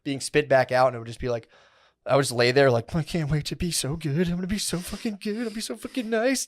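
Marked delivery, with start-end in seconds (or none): 3.0-6.5 high, eager, almost squealing; a mock giddy vow; giddy promise. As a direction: gush like a kid promising to be good. Retold giddy vow while lying there.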